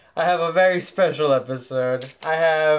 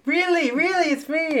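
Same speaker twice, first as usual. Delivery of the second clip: much higher, amazed disbelief; surprised mom; 10 semitones higher than usual. Retold or imitated. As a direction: gasp it like she can't believe it. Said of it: "she's like".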